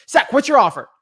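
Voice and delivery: the seller's real pushy version; rapid-fire demand. Shown as a demonstration. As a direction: shoot it out like gunfire. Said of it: "bang, bang, bang".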